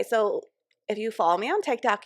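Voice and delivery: normal talking voice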